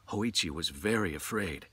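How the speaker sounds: ordinary delivery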